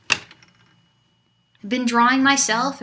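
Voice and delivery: ordinary talk, nothing marked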